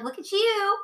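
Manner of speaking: high, sing-song mock cooing; imitated teasing admiration. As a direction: coo it like an impressed colleague, put on. Retells teachers teasing her.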